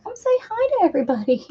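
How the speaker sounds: quick, high sing-song coax; high pet voice. Real, not acted. Owner urging her cat to greet the viewers, high sing-song.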